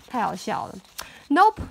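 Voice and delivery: plain reading voice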